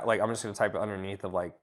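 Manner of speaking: ordinary delivery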